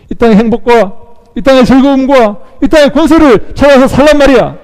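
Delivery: high, loud devil's scolding; acted outburst of contempt, building to the end; scornful rebuke. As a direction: berate them for such foolish hopes. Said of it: "지금 우리를 꾸짖고 있는 거예요".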